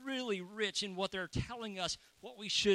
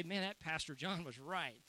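lower, softer muttered aside; rueful mutter; 4 semitones lower, 5 dB quieter than usual. Pretend. Guest at Thanksgiving dinner, grudging quiet admission. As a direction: mutter it to yourself in grudging defeat.

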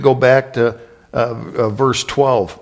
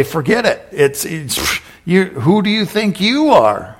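mock scoff, pitched up, who do you think you are; scoffing doubter; 5 semitones higher than usual. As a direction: sneer at the messenger, raised.

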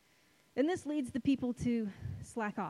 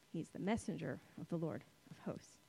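quiet repeat; 6 semitones lower, 9 dB quieter than usual. Real, not acted. Quietly repeats the verse to let it sink in.

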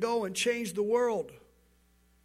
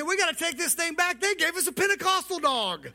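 exasperated couple; 7 semitones higher, 3 dB louder than usual. Retold or imitated. Couple's punchline complaint about dog.

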